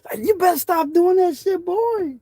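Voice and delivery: high-pitched mock mama scolding; voicing his mother telling him off; imitating mama. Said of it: "she was like".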